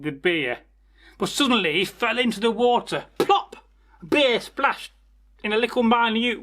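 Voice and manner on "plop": a very high, sharp plop; high squeaky plop; splash sound effect. Acted. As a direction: pop out the splash like a cartoon effect. Mouse falls into beer.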